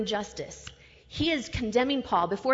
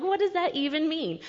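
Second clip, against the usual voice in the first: higher-pitched, puzzled question; retold bafflement; 7 semitones higher than usual. Preacher recalling first reading the verse, baffled. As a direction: replay your own confusion, pitched up. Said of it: "'cause I'm like".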